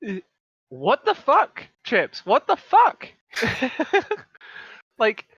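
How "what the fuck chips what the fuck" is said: loud, high disbelieving cry; shocked outburst. Acted. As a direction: blurt it in shock. Shocked at Chips smoking.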